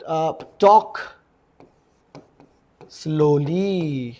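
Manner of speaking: very slow, drawn out; teacher modelling the words so students can copy, very slowly; slow demo. Shown as a demonstration. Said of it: "कृपया हळू बोला यार".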